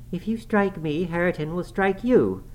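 put-on high-pitched young woman's challenge; defiant warning. A narrator's reading. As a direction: dare him, voice pitched well up. Girl defies her furious father-in-law.

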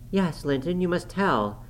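put-on high, urgent girl's voice; pleading young Catherine. A narrator's reading. Catherine urging her cousin to tell, high insistent plea.

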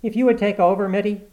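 high, loud, anxious appeal; nervous plea. A narrator's reading. Renshaw asking Mitty to take over, nervously. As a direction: plead nervously for rescue. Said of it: "said Renshaw nervously".

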